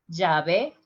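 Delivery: llave with a dj sound, "djave"; Latin American. Shown as a demonstration. Teacher demos continental key. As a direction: llave with a hard j. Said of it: "the rest of the continent".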